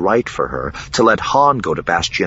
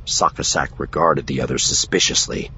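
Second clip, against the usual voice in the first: plain reading voice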